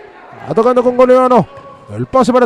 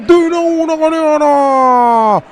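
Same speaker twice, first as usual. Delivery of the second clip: excited goal call; 4 semitones higher, slower than usual. Real, not acted. Scorer announced after goal.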